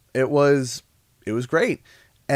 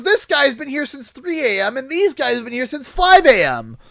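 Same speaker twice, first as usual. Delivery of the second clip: store manager; 11 semitones higher, 3 dB louder than usual. Retold or imitated. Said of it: "he goes".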